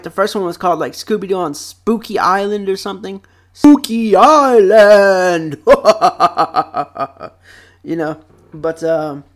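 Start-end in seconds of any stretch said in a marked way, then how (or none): 3.5-5.6 loud, drawn-out spooky wail; mock spooky announcer. Vlogger hams up a movie title, mock spooky. 5.7-7.3 loud, staccato villain laugh; mock spooky cackle. Vlogger caps the spooky title, hammy cackle.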